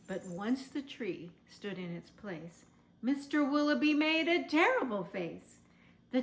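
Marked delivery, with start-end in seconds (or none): none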